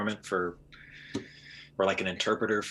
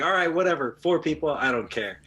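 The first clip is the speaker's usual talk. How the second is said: louder, higher imitated brush-off; mock dismissive; 5 semitones higher, 5 dB louder than usual. Imitating the driver giving in on four passengers, loud brush-off. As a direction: wave it away loudly, as a retold quote. Voices driver waving away the rule.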